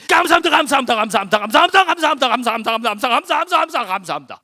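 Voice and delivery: high, shouted, swooping repetition; frantic rapid-fire thanks. Shown as a demonstration. Preacher demonstrating the final set of thanks, frantic loud chant. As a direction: belt out thanks in a breakneck run. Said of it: "투 세트 피니시".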